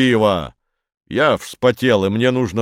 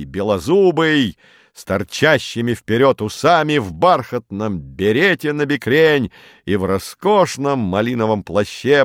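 plain reading voice

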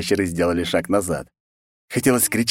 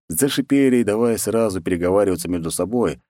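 plain reading voice

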